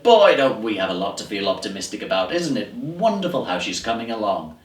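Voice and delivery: loud, gushing optimism from a doctor; beaming doctor. Acted. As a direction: deliver good news with hammed-up cheer. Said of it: "came out beaming".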